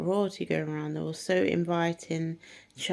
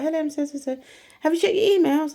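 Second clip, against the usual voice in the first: high, loud eager greeting; imitating an eager teacher on the phone, higher and louder; excited caller; 11 semitones higher, 8 dB louder, faster than usual. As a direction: burst in with news.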